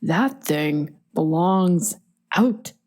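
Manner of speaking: slow, deliberate dad impression, word by word; mock-stern dad with heavy pauses; imitated dad. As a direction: imitate a dad laying down the law, very slowly. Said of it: "and he was like".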